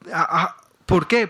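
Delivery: usual speaking voice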